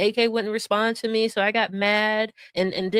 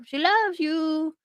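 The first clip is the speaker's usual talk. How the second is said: mocking; 6 semitones higher, much slower than usual. Real, not acted. Mocking the clip's love talk.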